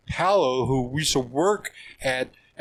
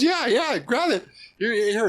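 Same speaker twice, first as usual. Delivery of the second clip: imitating an excited friend, much higher; eager urging; 10 semitones higher, 3 dB louder than usual. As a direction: urge him on, high and keen. Retold Paulo urging him.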